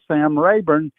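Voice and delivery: ordinary talk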